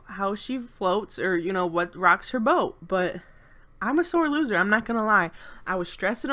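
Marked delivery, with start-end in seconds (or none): none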